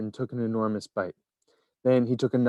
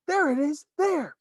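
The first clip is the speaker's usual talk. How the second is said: much higher pointing shout. A narrator's reading. A bystander shouting to the crowd, much higher. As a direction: point and cry out. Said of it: "someone else shouted".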